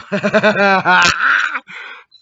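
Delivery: big cackling laugh, high; loud high laugh at the joke. Real, not acted. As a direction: burst out laughing hard.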